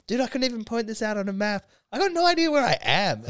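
put-on high-pitched drunken whine to the shop guy; drunk self. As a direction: act out your hammered self, pitch climbing high. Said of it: "when I'm so drunk".

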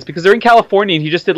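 normal talking voice

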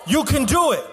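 high, driving sermon delivery; rally the congregation, pitched high and emphatic; preaching at full force. Real, not acted. Pastor challenging church to fight the enemy, fervent preaching.

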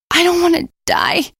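high, pleading, near tears; desperate. Acted. Nikki begging her friends, desperate and high. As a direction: beg not to die. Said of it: "she said desperately".

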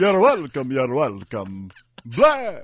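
hammy vampire voice, swooping pitch; Dracula. Acted. Co-host accepting thanks in character, vampire impression. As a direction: answer thanks as a campy vampire. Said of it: "I did the vampire voice".

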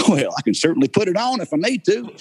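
high, exaggerated Southern accent; put-on Southern accent. Actor reassuring directors he can put it on, Southern accent. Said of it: "you don't have much of an accent".

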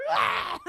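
loud, high rag-doll yell; flailing yell. Retold or imitated. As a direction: yell as if being shaken about. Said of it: "I'm like a rag doll".

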